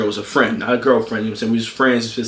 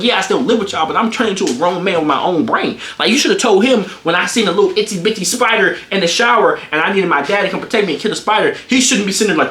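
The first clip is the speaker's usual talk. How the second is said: ordinary talk, nothing marked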